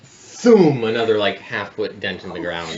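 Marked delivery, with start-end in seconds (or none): 0.0-0.8 booming thoom impact sound; dull thud. Acted. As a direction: slam it like a giant club. GM voicing second club impact.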